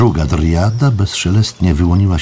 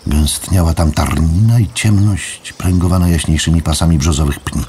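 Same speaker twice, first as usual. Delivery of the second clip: usual speaking voice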